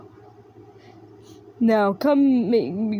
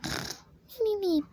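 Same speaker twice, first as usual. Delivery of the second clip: much higher and much softer, squeaky; tiny squeaky voice; 10 semitones higher, 7 dB quieter, slower than usual. Acted. Squeaky odd voice.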